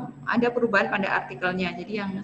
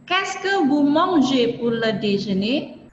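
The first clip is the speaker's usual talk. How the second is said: slow, careful French reading; slow model question; slower than usual. Shown as a demonstration. Teacher repeats the example question to students, slow. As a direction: read it slowly for the class.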